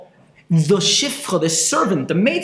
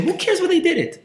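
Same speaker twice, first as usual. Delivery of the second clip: incredulous; 12 semitones higher, faster than usual. Retold or imitated. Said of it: "I was thinking one day".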